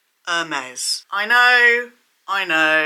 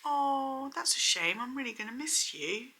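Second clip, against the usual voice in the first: clueless sympathy; 5 semitones higher, 7 dB quieter than usual. Retold or imitated. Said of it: "My response to that".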